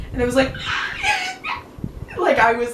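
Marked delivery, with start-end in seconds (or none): 0.6-2.1 high, wordless mock sob; imitated crying. Student answering the idol through tears, imitated crying. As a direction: squeak out a hammed-up tearful wail. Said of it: "in tears the entire time".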